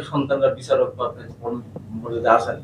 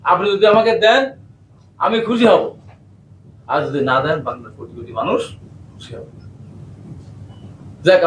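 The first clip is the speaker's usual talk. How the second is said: normal talking voice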